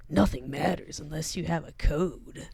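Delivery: brooding gravel-free edgelord read. Retold or imitated. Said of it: "That's just my default edgelord voices".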